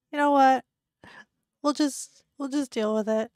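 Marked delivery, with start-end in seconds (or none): none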